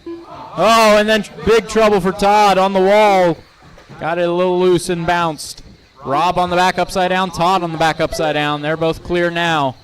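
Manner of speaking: live race call, quick and lively; motorsport commentator. Real, not acted.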